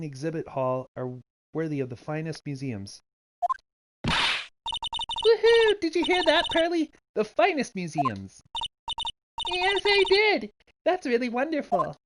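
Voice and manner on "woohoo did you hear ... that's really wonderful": much higher and louder, thrilled; Maya and Pearly cheering. Acted. Girls celebrating the museum praise, high. Game character voiced.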